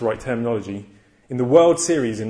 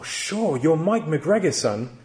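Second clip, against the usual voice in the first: higher-pitched, delighted imitated welcome; imitated smiling guard, pitched up and welcoming; 4 semitones higher, slower than usual. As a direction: voice the guard suddenly all smiles. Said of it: "he said with a smile".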